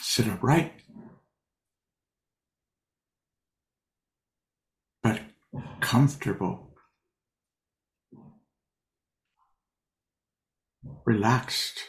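unhurried, leave long pauses between short phrases; guided meditation. Real, not acted. Teacher settles sitters into posture and breath, guided meditation.

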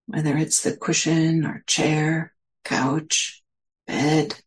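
unhurried meditation guidance with long pauses; guided meditation. Real, not acted. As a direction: gently guide listeners inward, leaving space between phrases.

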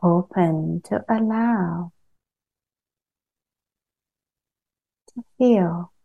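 calm meditation guide with long pauses between phrases; unhurried, evenly pitched guidance, leaving long silences; guided meditation. Acted. Teacher guiding a group to settle into body and earth, guided meditation.

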